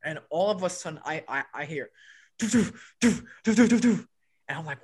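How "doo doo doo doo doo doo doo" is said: quick, punchy "doo" bursts; imitated punching noise. Storyteller mimicking a sound he suddenly heard, imitated thudding noise. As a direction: fire off short percussive doos.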